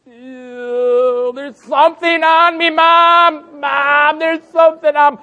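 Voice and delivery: put-on high, loud squeal of a disgusted little kid; whiny little boy. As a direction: squeal like a toddler with cake on his fingers. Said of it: "touched it and he's like".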